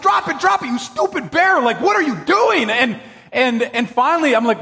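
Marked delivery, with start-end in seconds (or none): 0.0-2.7 high exasperated yell at the bear. Retold or imitated. Pastor scolding the bear that took his sauce, high yell. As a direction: scold the stupid bear at full pitch. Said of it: "I'm just".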